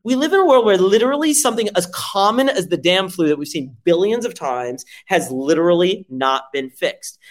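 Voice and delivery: ordinary talk, nothing marked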